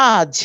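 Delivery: আজ as one drawn-out "aaj"; long vowel. Shown as a demonstration. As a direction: hold the "aa" of আজ. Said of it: "আ এর উচ্চারণ কতটুকু দীর্ঘায়িত".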